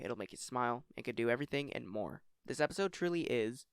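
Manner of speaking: ordinary delivery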